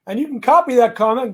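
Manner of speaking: ordinary talk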